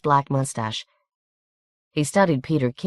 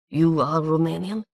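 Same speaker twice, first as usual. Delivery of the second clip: put-on Hungarian accent; 2 semitones higher than usual. A narrator's reading. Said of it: "with a harsh Hungarian accent".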